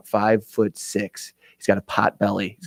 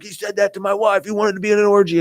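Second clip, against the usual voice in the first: higher-pitched mock outrage, no real volume; retold screaming; 8 semitones higher than usual. Husband confronting the buddy about his wife, mock outraged. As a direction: imitate an aggrieved husband protesting. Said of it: "the guy's screaming at him".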